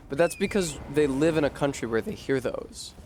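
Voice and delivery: ordinary delivery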